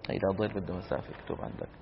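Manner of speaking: normal talking voice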